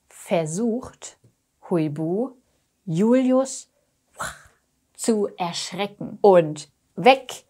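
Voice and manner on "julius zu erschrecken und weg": usual speaking voice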